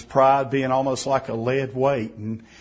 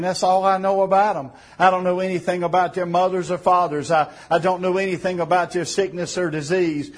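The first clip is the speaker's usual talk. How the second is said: higher-pitched, insistent pulpit delivery; fervent preaching; 5 semitones higher than usual. Real, not acted. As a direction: press each point home like an impassioned preacher. Preaches with rising fervour.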